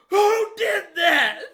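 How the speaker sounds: slow, high, loud shout from down the hall; distant teacher shout. Retold or imitated. Teacher shouting down the hallway at whoever kicked the bottle, loud and slow. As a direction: bellow it from far down the hallway. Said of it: "Miss Arp in the distance".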